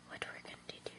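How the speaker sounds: very soft, voiceless aside; whispering to self. Real, not acted. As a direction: whisper it to yourself. Muttering to self while busy.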